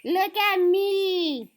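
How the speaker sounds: high, loud, slow proud call; showing off. Acted. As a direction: show off delightedly. Pip shows off fuzz.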